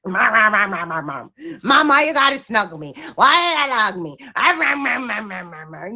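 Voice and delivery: whiny, grumbly dog voice; voicing the grumbling dog. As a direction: whine "mom" over and over like a complaining dog. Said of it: "he's grumbling".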